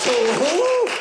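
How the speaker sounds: shocked. Acted. Shocked at losing the bid.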